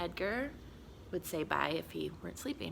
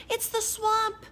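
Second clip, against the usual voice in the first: high, loud yelp of fright; frightened cry; 5 dB louder than usual. Acted. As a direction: yelp like scared kids. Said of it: "cried the kids".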